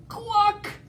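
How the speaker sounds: very high, sharp hen cluck; loudest cluck. Acted. Edna scaring T. rex, high loud cluck. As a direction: cluck it hard. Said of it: "clucked her loudest".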